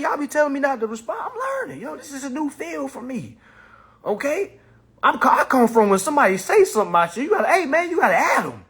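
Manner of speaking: ordinary talk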